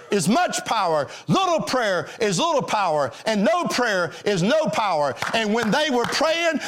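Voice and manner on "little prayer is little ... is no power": ordinary talk, nothing marked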